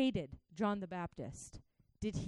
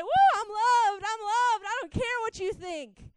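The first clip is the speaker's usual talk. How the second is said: loud and high, whooping with joy; jubilant shout; 15 dB louder than usual. Pretend. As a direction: shout it out like you're jumping up and down. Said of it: "jump up and down and say".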